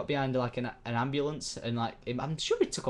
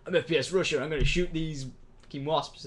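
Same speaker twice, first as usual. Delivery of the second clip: put-on Russian accent; 3 semitones higher than usual. Hospital team doing a mock Russian accent. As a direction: play a gung-ho Russian gunman.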